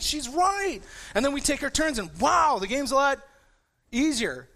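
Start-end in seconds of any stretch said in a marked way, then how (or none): 0.0-0.8 excited. Retold or imitated. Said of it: "I'm like".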